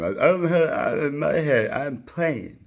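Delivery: high, loud slurred nonsense; hammed-up garbled answer; garbled mumble again. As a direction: mumble it like your mouth won't work. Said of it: "and I just did the same".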